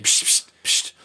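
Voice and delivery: hissing psst, unvoiced; shushing. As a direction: hush everyone with a sharp psst. Shushing for the boss.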